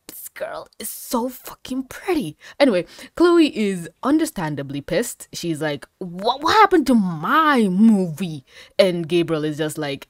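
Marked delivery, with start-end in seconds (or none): 0.1-2.4 hushed, admiring whisper. Real, not acted. Gushing to viewers about the pretty girl, whispered. 6.0-8.4 mock outrage. Voicing outraged Chloe.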